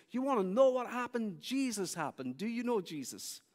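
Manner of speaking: high, emphatic retold announcement; acted evangelist's eager challenge; excited proclamation. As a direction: announce it boldly, starting loud and high. Ray realizes, answers with fervor.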